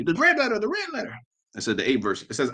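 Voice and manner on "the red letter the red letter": high-pitched mocking voice. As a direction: put on a high, silly parroting voice.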